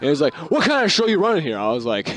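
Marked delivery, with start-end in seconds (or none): 0.5-1.6 high, huffy demand; a huffy customer challenging the cashier, pitched up; indignant customer. Retold or imitated. Angry customer voiced.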